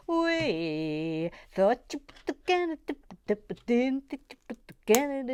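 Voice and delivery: a hint of Canadian; playful Canadian impression; mock Canadian accent. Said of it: "I love the Canadian accent".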